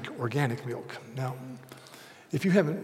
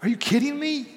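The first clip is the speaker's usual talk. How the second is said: high, loud disbelief at the price; shocked outrage; 13 semitones higher, 4 dB louder than usual. Retold or imitated. Husband reacting to his wife's milk purchase, outraged and high.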